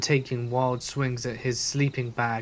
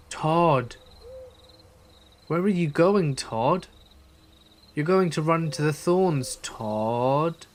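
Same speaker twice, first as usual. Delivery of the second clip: higher sing-out call; eerie calling voice; 4 semitones higher, slower than usual. Retold or imitated. Unseen voice in the woods to Todd, calling out. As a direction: call out into the woods, drawn-out names.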